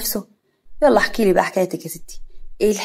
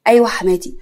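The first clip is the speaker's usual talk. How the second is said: ordinary delivery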